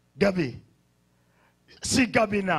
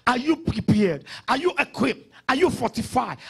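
ordinary delivery

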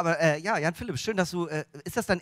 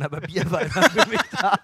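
louder, fake-sentimental ending; milk it like a heartfelt toast; mock tearful tribute; 3 semitones higher, 5 dB louder than usual. Joking to the audience that beer is his only friend, hammed-up sincerity.

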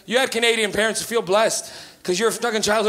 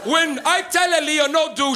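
ordinary talk

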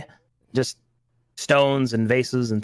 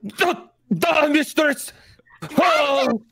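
alarmed character voice; 12 semitones higher, much slower than usual. Pretend.

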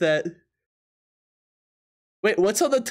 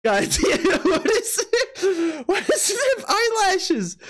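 high, slow, laughing disbelief; 10 semitones higher, slower than usual. Real, not acted. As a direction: ask it while cracking up. Laughing at drawn eyelashes.

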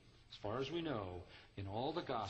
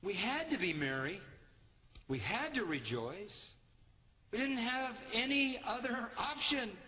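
higher, louder, rising insistence on to; joyful insistence; 10 semitones higher, 3 dB louder than usual. Real, not acted. Preacher insisting heaven had to rejoice, exultant. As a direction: push the joy, no choice about it.

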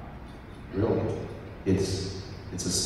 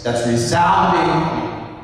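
deep voice of God; 6 semitones higher, 7 dB louder, much slower than usual. Shown as a demonstration. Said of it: "I love the voice of God".

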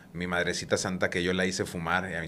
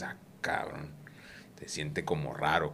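hushed murmur; 5 semitones lower, 8 dB quieter than usual. Retold or imitated. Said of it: "Entonces dices".

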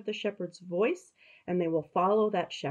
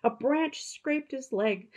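higher, storybook narration; reading to children; 6 semitones higher than usual. A narrator's reading. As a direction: tell it like a bedtime story to kids.

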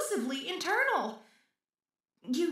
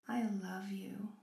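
unvoiced, softer lovesick whisper; 5 semitones lower, 8 dB quieter than usual. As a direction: breathe it as a secret. Confessing love to creator.

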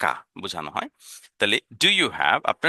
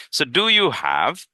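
very slow, each word drawn out for learners; teacher modelling English slowly; slow modelled question; 3 semitones higher, much slower than usual. Shown as a demonstration. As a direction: spell it out slowly for the class.

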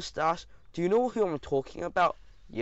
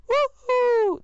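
very high, loud whoop; cheering; 10 dB louder than usual. A narrator's reading. Crowd going wild after a takedown, cheering. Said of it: "they cheered".

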